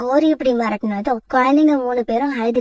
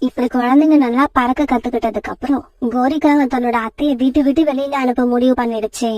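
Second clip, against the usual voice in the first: normal talking voice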